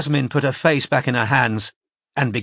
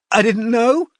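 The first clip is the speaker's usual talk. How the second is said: high, crying through fingers; tearful; 12 semitones higher, 5 dB louder than usual. A narrator's reading. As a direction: sob it out behind her hands. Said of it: "she said, through her fingers".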